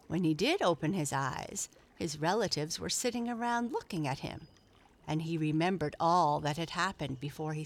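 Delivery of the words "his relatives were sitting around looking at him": plain reading voice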